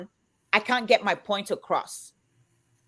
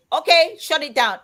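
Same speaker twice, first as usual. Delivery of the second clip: higher, louder dismissive brush-off; ex-husband shutting her down; 7 semitones higher, 6 dB louder than usual. Retold or imitated. Ex-husband cutting off his wife, loud dismissive brush-off. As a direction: cut the talk short, loud and high. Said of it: "He's like".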